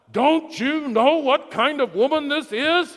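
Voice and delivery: high, loud furious yell at Jesus; furious scream. Retold or imitated. The Pharisee Simon screams at Jesus in fury. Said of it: "he screamed at Jesus".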